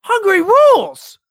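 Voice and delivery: high, loud burst of enthusiasm; excited outburst. Real, not acted. Cheering about Hungary's flat tax, high excited burst. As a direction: cheer it out, genuinely thrilled.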